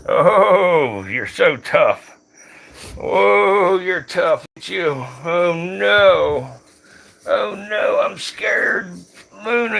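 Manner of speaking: sarcastic pretend panic, I should run drawn out; mock scared. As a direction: tease the tough cobra with fake fright. Mock fear taunting the cobra.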